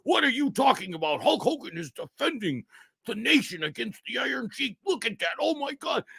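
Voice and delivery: high-pitched, wide-eyed fan; high mock outrage of a wrestling believer; outraged wrestling fan. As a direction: squeak it out like a starstruck fanboy. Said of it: "They'd be like".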